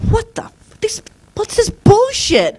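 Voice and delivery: high, slow, disbelieving exclamation; outraged outburst. Retold or imitated. Woman to the TV news, high indignant outburst. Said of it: "found myself sitting bolt upright saying".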